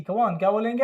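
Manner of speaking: normal talking voice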